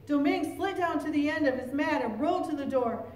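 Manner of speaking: usual speaking voice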